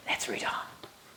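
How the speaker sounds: slow secretive whisper. Pretend. Reader invites children onward.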